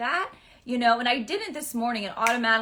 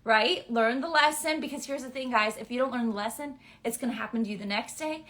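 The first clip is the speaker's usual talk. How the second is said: usual speaking voice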